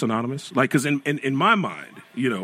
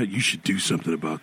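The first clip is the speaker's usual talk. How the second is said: lower, soft judgmental murmur; low mock disapproval; 7 semitones lower, 4 dB quieter than usual. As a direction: grumble it like a disapproving husband. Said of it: "what did I ever say".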